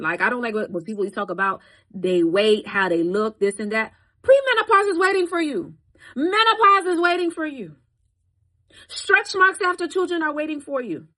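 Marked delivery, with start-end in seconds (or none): 4.2-11.1 much higher and louder, each line falling hard; preaching warning. Acted. Warning the women who attack others, loud preaching. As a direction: call it out like a warning from the pulpit.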